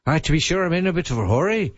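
quick, breezy mock Irish accent; tossed-off stage Irish accent, hurried; lightly imitated Irish accent. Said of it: "in an Irish accent".

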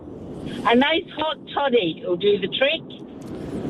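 real Scottish lilt; easygoing Scottish burr; Scottish accent. Real, not acted. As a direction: speak in a broad Scottish accent. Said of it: "how good's that accent".

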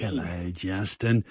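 put-on low, soft old grandpa greeting. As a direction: greet him like a doddery grandpa. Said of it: "You sound like a grandpa when you said that".

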